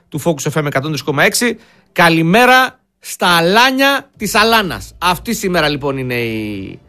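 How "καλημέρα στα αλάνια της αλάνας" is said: slow, drawn-out announcer title; show title announcement. Acted. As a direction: announce the show's title slowly. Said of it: "η τιτλοδοσία που δίνω στην εκπομπή".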